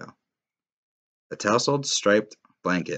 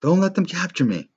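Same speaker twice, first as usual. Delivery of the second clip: panicked yell; 12 semitones higher, 5 dB louder than usual. Acted. Neek yelling during arrest.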